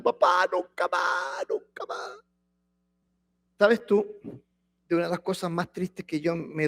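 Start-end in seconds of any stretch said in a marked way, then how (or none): 0.0-2.2 slow fake-sobbing kid begging; mock crying child. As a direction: whimper like a child caught out. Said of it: "Y llorando". (niño fingiendo llanto, suplicante)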